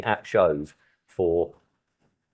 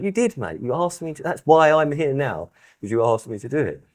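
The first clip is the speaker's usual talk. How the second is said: higher insisting voice, playing himself; mock insisting; 7 semitones higher than usual. Retelling how he insisted to Tom, mock exasperated. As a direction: press the point on a stubborn mate. Retold spat with show organiser.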